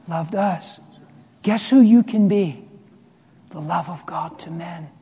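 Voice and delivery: ordinary talk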